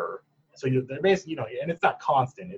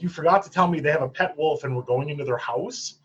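a bit higher, mock-incredulous question; mild retold incredulity; 4 semitones higher, 4 dB louder than usual. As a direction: repeat it back in disbelief, lightly acted. Retold disbelief at pet wolf news.

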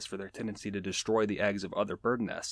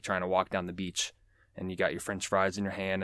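ordinary delivery

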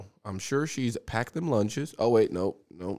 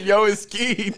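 giggling through a higher Skeet line; laughing as you quote Skeet to the group; 8 semitones higher, 4 dB louder, slower than usual. Retold or imitated. Quoting Skeet's text while cracking up. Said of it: "He said".

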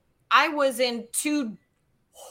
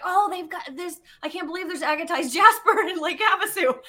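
gushing over a find, higher pitched; excited; 6 semitones higher than usual. Retold or imitated. Rock hunter telling fiance about her beach find, excited. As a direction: marvel in a high, eager voice. Retells her delight at rocks.

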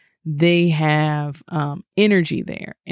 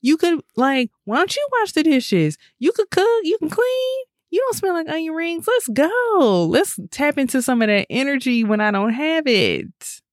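much higher, coaxing and pushy; teasing high voice; 12 semitones higher than usual. Retold or imitated. Urging her boo to use his energy, high coaxing voice. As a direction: squeak it out like nudging a lazy partner into chores. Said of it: "I was like".

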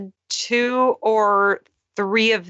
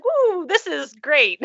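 high, excited cheer; 8 semitones higher, slower than usual. Retold or imitated. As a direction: cheer it with delight. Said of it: "I was like".

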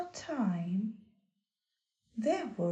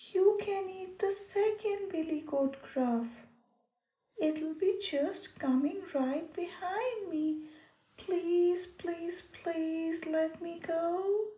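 high, begging little goat voice; pleading little goat; 7 semitones higher than usual. Acted. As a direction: plead for your life, small and high. Goat begs troll.

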